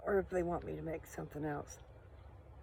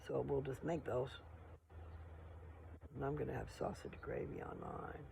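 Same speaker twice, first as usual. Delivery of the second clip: usual speaking voice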